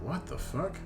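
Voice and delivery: ordinary talk, nothing marked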